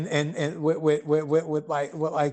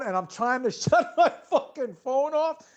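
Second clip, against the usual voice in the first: telling it through laughter; laughing; 7 semitones higher than usual. Real, not acted. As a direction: laugh mid-sentence.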